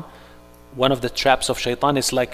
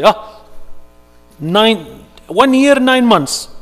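high, loud, slow gloating; triumphant taunt; 9 semitones higher, 7 dB louder, much slower than usual. Retold or imitated. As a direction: rub the victory in his face. Believer mocking Shaitan with victory.

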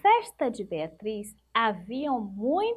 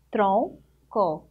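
"Tronco" as two parts, tron, pause, co; split into syllables; 2 semitones lower than usual. Shown as a demonstration. Teacher counting two syllables for pupils, "Tronco" split apart. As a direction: break "Tronco" after "Tron" with a clear gap. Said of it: "Duas sílabas".

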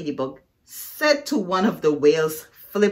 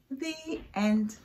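usual speaking voice

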